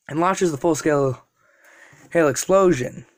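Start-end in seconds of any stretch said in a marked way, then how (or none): none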